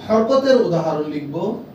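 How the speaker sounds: ordinary delivery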